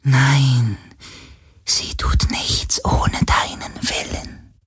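slow whisper after a firm no; firm ghost whisper. Acted. Ghost insists to Galen the Helix obeys him, whispered.

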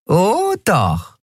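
gleefully mean, high little voice; high-pitched wicked teasing; Nelen. A narrator's reading. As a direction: savour it with a nasty grin. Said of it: "Nelen grinste fies".

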